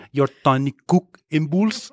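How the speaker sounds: mock French accent, slow. Cohost quoting Pattinson, mock French accent. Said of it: "does a French accent and says".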